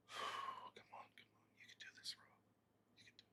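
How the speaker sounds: barely audible whisper, all breath; whispered self pep talk. Acted. Streamer urging himself that he can do this, whispered. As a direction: psych yourself up under your breath.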